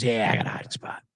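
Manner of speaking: hushed, fast whisper; whispered secret. Retold or imitated. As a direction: whisper it like a guilty confession. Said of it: "He goes".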